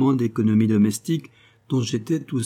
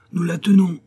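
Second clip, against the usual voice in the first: cri excité, plus aigu et plus fort, à bout de souffle; lancez-le comme une chasseuse essoufflée qui tient enfin la poule; cri haletant; 8 semitones higher, 6 dB louder than usual. A narrator's reading. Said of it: "criai-je toute haletante". (Nell crie à Master Cronin, plus haut et plus fort, haletante)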